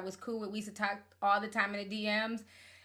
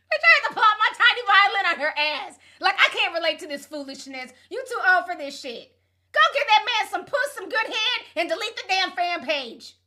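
loud excited rant; 8 semitones higher, 8 dB louder than usual. Real, not acted. Worked-up rant about the fan.

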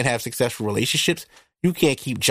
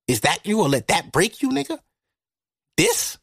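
incredulous, high and dismissive; mock disbelief; 7 semitones higher than usual. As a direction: pitch leaping up on a scoffing question. Mocking a small setback.

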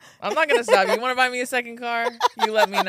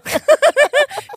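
loud high giggle; high laugh; 16 dB louder than usual. Real, not acted. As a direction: burst out giggling.

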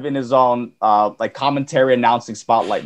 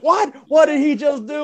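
mock announcer yelping in disbelief, much higher; a shocked commentator squeal; imitating stunned commentator; 13 semitones higher than usual. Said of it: "and he was like".

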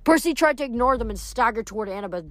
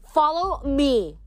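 high, drawn-out rallying cry; rally the other demons to you; stretched, pitched-up command; shrieking; 6 semitones higher, much slower than usual. A narrator's reading. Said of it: "Seraphine shrieked".

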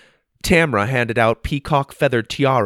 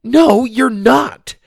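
slow, much higher, louder retort; shot back; 7 dB louder, much slower than usual. A narrator's reading. Sophie snapping back at Tiffany's fake apology. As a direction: fire it back at her. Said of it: "Sophie shot back".

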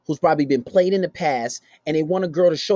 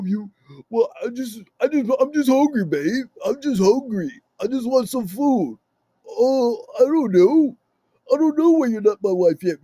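mock whiny boyfriend; 3 semitones higher than usual. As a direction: whine like a hungry, needy boyfriend. Mocking a needy boyfriend.

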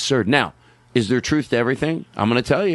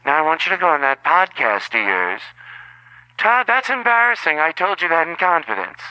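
Todd's mom; 4 semitones higher than usual. Pretend. A mother scolding Todd about his podcast, higher shrill-free nagging voice. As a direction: nag like an embarrassed mother. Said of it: "it's your mother".

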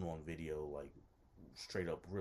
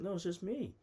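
high-pitched reassuring reply; lightly mimicked hostess, pitched well up; imitated woman; 12 semitones higher, 3 dB louder than usual. Voices the woman's reassuring answer.

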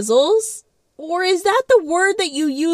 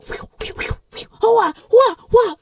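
mock sword whooshes; 9 semitones higher than usual. Mom swinging two swords.

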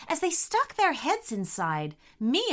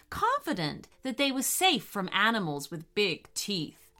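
plain reading voice

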